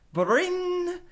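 high ringing tone; phone ring. A narrator's reading. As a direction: ring like a telephone.

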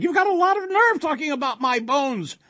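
put-on high, loud scolding old woman; fearless, affronted old wife; indignant cry. A narrator's reading. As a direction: snap back at the monster, pitch way up. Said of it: "the old woman cried".

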